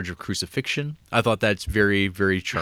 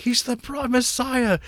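much higher acted voice; high-pitched mock quote; 13 semitones higher, slower than usual. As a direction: pitch it way up, imitating the character. Acted quote of strangled man's exchange.

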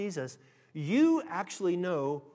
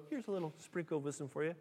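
small, soft, quick voice; understated, offhand little handout; stingy mock offer; 9 dB quieter, faster than usual. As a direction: toss it off softly and fast. Mock stingy God, against pouring out.

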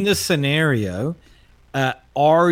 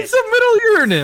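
high protest; 5 dB louder, faster than usual. Real, not acted. Defending himself in mock protest.

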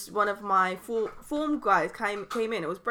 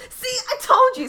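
much higher, louder mock schoolgirl; triumphant pupil; 6 dB louder than usual. Pupil proved right about teacher's pregnancy, high crowing imitation. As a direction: crow it like a vindicated pupil.

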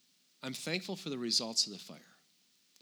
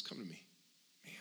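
much softer, tender invitation; loving father; 6 semitones lower, 18 dB quieter than usual. Retold or imitated. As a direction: gently coax like a loving dad. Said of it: "this loving father".

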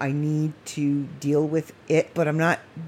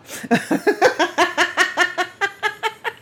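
bubbling high-pitched laugh; high giggle; 14 semitones higher than usual. Real, not acted. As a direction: giggle at your own cheekiness. Laughing at her own joke.

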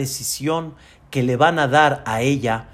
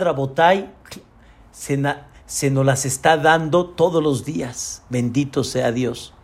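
ordinary talk

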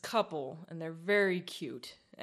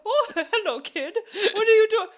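squeaky lizard voice, much higher and louder, laughing through it; 10 semitones higher, 6 dB louder than usual. Pretend. Podcast host voicing a lizard in a bucket, high laughing squeak. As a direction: play a fussy little lizard shooing a kid out of his bucket, cracking up.

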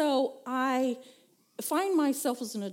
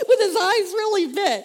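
ordinary delivery

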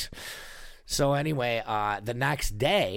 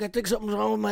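worried brother; 10 semitones higher, much faster than usual. Retold or imitated. Brother worried about his lip.

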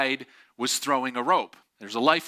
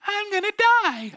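very high, loud mimicking squeal; hammed-up squeaky cry; oblivious echo; 5 dB louder than usual. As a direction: imitate the swimmer's clueless call back. Oblivious swimmer echoing the warning.

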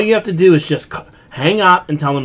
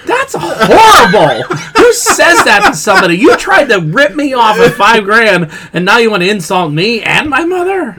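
mock outrage; 7 semitones higher, 4 dB louder than usual. Telling friends how he answered the scammer, high laughing outrage. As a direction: shriek it, half laughing, offended.

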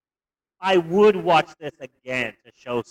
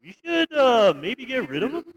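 put-on a light, friendly Canadian accent with a tacked-on eh; lightly voiced cheerful Canadian neighbour; mock Canadian accent; 2 semitones higher, 3 dB louder than usual. Imitating a polite Canadian Nazi for his co-host, in a light Canadian accent. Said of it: "that Canadian sort of attitude of, like".

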